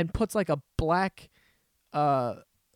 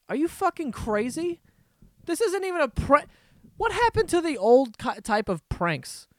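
outraged rant; 10 semitones higher than usual. Real, not acted. Ranting at the prank video makers, high incredulous yell. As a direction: yell it in disbelief.

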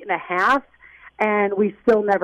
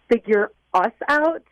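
ordinary talk, nothing marked

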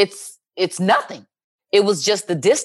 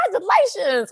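high, singled-out cheer; high-pitched excited well-wishing; retold congratulations; 11 semitones higher than usual. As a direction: call out happily with pitch way up. Retells calling to congratulate sister.

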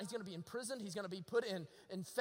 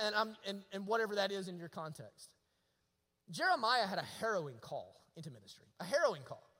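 ordinary talk

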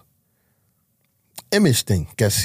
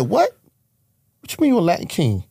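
a sharp, much higher question in disbelief; shocked disbelief; 10 semitones higher than usual. Retold or imitated. Father seeing his son's gang sign in a photo, stunned high disbelief. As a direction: recoil at the photo, pitch jumping up.

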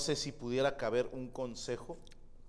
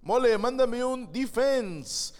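loud, slow, high called-out read; mock fan request; 11 semitones higher, 8 dB louder, slower than usual. Reading a fan's message aloud, loud and slow.

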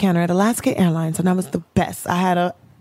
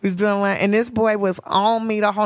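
normal talking voice

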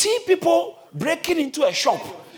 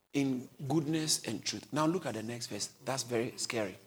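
much lower, softer, sober tone; hushed; 11 semitones lower, 8 dB quieter than usual. Real, not acted. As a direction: drop to a hushed serious voice. Sermon quietly dropping.

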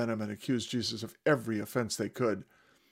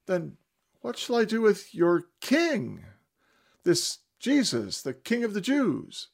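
much higher, slow, louder appeal; Pilate asking crowd; 9 semitones higher, 5 dB louder, slower than usual. Acted. Pilate asking the crowd what to do with Jesus. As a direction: plead with the mob, drawn out. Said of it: "he asked them".

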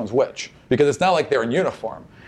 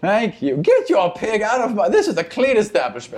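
snooty shopkeeper; 6 semitones higher than usual. Acted. GM voices annoyed melon woman.

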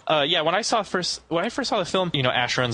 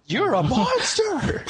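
high, drawn-out horrified cry; mock accusation; 14 semitones higher, much slower than usual. Host acting out regained memories of Mewtwo, mock horror. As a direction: stretch it out in fake outrage.

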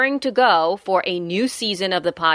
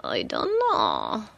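funny voice; 10 semitones lower, 5 dB quieter, slower than usual. Acted. Echoing co-host in funny voice.

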